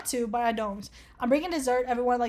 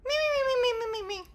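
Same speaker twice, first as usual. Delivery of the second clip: very high, squeaky string of mimicked snores; cartoon snore imitation; 12 semitones higher, much faster than usual. Shown as a demonstration. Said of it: "How do you snore?".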